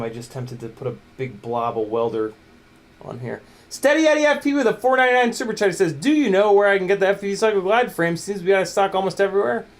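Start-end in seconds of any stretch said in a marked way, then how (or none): 3.7-9.7 high, loud, fast read-out; super chat read-out. Acted. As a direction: rattle off a viewer's message, pitched up and loud. Reads out viewer's super chat.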